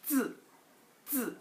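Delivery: 쯔 started high and dropped sharply; fourth-tone demo. Shown as a demonstration. Teacher models the word for letter, fourth-tone demo. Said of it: "사성으로 발음하죠".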